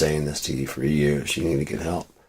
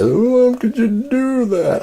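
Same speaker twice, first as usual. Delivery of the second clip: high mocking wail, much louder; mock crying ex; 4 dB louder than usual. Ex crying over the fixed photos, high mock wail. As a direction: whine it like a fake-sobbing ex. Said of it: "and of course she cries".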